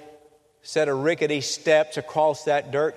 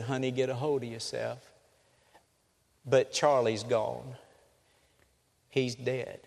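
gentle sad news; 3 semitones lower, 5 dB quieter than usual. Retold or imitated. Father tells wife son died.

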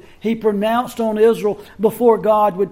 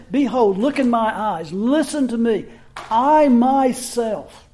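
Christ asserting his own authority, emphatic; emphatic I myself. Acted. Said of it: "Christ is saying".

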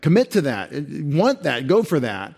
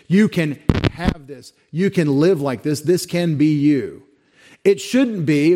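higher and louder, pressing the hymn words; fervent preaching; 3 semitones higher, 5 dB louder than usual. Acted. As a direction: preach it out, urging them to commit. Urging hearers with hymn.

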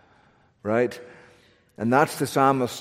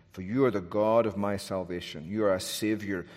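ordinary talk, nothing marked

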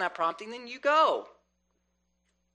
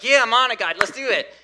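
higher, louder eager promise to God; eager yes; 6 semitones higher, 10 dB louder than usual. Retold or imitated. Believer tells God they are on it, higher and louder. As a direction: jump in, all gung-ho. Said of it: "you're like".

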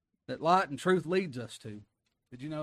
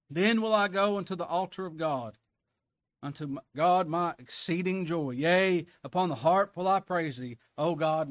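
louder, lifted preacher reading; preaching the verse; 3 semitones higher, 5 dB louder than usual. Acted. Preacher proclaiming the altar verse to the congregation, loud. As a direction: proclaim it joyfully.

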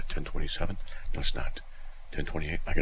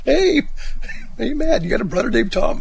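taunting schoolkids; 6 dB louder than usual. Retold or imitated. Kids teasing him at school.

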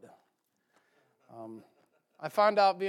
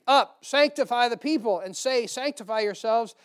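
louder, declaiming King James reading; scripture reading; 5 semitones higher, 6 dB louder than usual. Acted. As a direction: read the Bible passage out to the congregation. Preacher reading scripture aloud.

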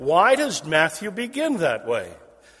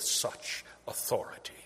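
awed whisper; 4 semitones lower, 10 dB quieter than usual. Acted. People astounded at his authority.

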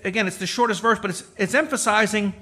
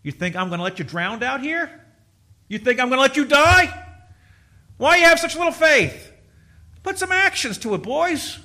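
rising, loud rebuke as Jesus, higher; Jesus calling out to a frightened disciple, louder; Jesus rebuking; 6 semitones higher, 5 dB louder than usual. Acted.

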